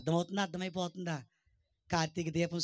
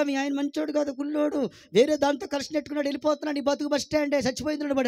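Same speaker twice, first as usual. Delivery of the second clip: Satan taunting; 7 semitones higher, 6 dB louder than usual. Retold or imitated. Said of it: "అంటాడు ఆడు".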